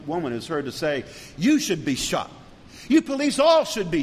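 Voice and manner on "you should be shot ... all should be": higher and louder hateful shout; angry yell. Retold or imitated. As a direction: spit it at the officers, rising to a shout. Woman yells at police.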